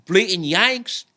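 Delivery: high, slow, hammed-up British accent; bad British accent. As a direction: drawl it out like a grumpy Brit in a British accent. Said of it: "moving on from bad accents".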